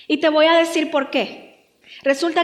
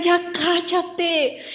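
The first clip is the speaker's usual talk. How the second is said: very drawn-out, higher exasperated voice; slow, whiny telling-off; annoyed crowd; 4 semitones higher, much slower than usual. Acted. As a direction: stretch it out like a fed-up bystander. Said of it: "le decían".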